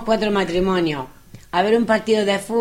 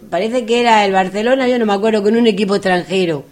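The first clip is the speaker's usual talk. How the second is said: ordinary talk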